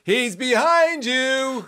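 slow, high panto villain warning; panto crowd callback. Acted. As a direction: call it out like a panto dame. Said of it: "finish the panto phrases".

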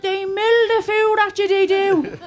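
a husband back from war, rattled and loud; shell-shocked husband. Retold or imitated. Husband tells wife about Aldi, rattled and loud.

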